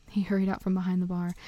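ordinary delivery, nothing marked

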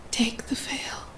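soft, whispered rasp; splintering rasp. A narrator's reading. Masked man begging to be unveiled, in a hushed rasp. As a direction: urge it under the breath, barely voiced. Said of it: "he rasps, voice splintering".

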